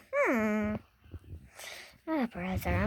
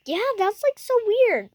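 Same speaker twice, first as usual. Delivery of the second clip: excited; 10 semitones higher, 6 dB louder than usual. Pretend. Toy animal agrees excitedly.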